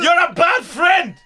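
high, loud and slow; hammed-up boss shout; mock shout. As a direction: yell it again at him. Improv quarrel shouting.

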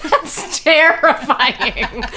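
laughing hard, drawn out. Real, not acted. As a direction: crack up while speaking. Laughing at creepy whisper.

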